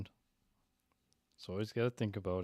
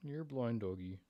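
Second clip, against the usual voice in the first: soft doggy talk; 9 dB quieter than usual. Real, not acted. Owner softly cooing to his blind old dog. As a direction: coo gently at the blind pet.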